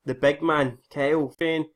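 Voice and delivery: ordinary delivery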